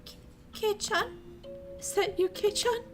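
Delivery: put-on a scared girl hoping it's really him; caged girl calling to her friend, frightened. Imprisoned girl hears Keiichi.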